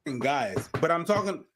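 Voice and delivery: ordinary talk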